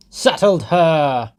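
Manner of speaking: slow jeering yell; crowd shouting. A narrator's reading. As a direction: bellow it out like a jeering mob. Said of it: "they shouted in the crowd".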